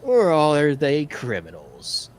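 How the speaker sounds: theatrical villain with swooping pitch; gang leader. Acted. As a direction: taunt them like a swaggering gang boss. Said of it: "he's like".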